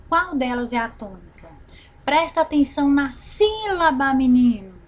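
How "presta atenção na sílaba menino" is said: calling to a pupil. Pretend. Teacher demonstrates with a call to a boy, calling out.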